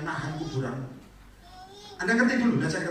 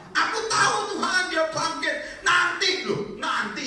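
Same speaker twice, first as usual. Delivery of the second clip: much higher, loud whiny protest; Marta insisting; 6 dB louder, slower than usual. Retold or imitated. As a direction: whine it high like a stubborn sister. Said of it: "Sudah tahu Marta ngomong apa?".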